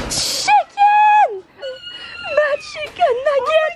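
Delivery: very high shrieked pet name; joyful shriek. Real, not acted. Greeting her girlfriend at last, high joyful shriek. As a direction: scream her name with joy and tears.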